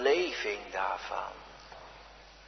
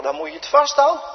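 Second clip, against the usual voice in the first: much higher, louder and fast, rising on "vasthouden"; sudden climax of fervent preaching; burst of preaching; 12 semitones higher, 6 dB louder, much faster than usual. Acted. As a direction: fling the line out with full conviction. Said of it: "ik mag u een veel rijker woord preken".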